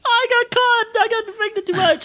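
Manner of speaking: retelling Maria's words in a squeaky put-on voice; mimicking Maria. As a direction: pitch it way up like a whiny girl. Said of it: "and she goes".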